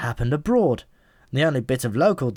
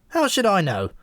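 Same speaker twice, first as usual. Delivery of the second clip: snapped; 7 semitones higher than usual. A narrator's reading. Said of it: "snapped the pigeon in a sudden flurry of bad temper".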